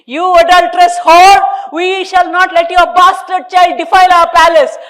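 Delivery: high, loud furious screech; enraged husband screeching at wife. A narrator's reading. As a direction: screech the insult at her. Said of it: "Desai screeched".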